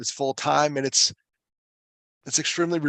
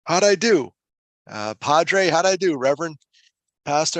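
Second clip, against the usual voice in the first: keen, pitched-up and louder plea for a verdict; anxious hopeful asking; eager asking; 5 semitones higher, 6 dB louder than usual. Retold or imitated. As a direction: fish eagerly for praise from the pastor. Eagerly asks pastor for feedback.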